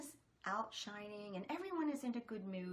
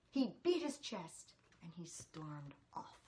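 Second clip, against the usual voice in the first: ordinary delivery, nothing marked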